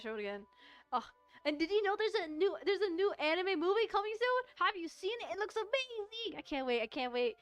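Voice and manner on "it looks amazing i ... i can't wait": usual speaking voice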